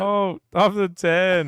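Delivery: high, loud and drawn out, half a gasp; excited realization. Real, not acted. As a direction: stretch it slowly in real amazement. Realizing the bank shot.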